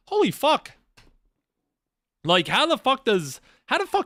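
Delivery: high, stunned gasp of disbelief; shocked exclamation. Retold or imitated. Streamer retelling his shock at a KYS post, high and stunned. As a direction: blurt it out as if you just read something awful. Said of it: "But I was like".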